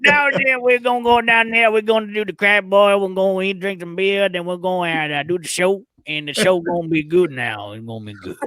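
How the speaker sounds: put-on thick Louisiana Cajun accent, starting high and sliding down; Coach O. Host taking co-host's cue, mock Louisiana coach accent. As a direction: run it together in a hammy Cajun coach voice. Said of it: "Coach O thing going".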